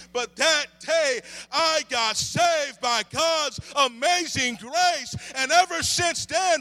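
high, loud sermon delivery; impassioned preaching. Real, not acted. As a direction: testify at full force, stretching key words. Preaches at full force.

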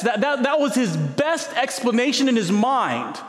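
high, impassioned preacher build; fervent preaching. Acted. Preacher driving home God's promise to the congregation, impassioned. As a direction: preach it high with full conviction.

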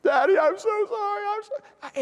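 put-on very high child whimper; mock crying. Caught daughter pleading with daddy, imitated crying. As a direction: imitate a kid pleading through tears. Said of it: "immediate crying".

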